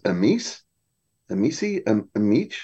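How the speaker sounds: halting, questioning stabs at "Amici"; puzzled guesses at pronunciation. Retold or imitated. A colleague asks how to say the word, puzzled guesses at "Amici". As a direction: try "Amici" several wrong ways, each as a question. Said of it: "I don't know how to pronounce".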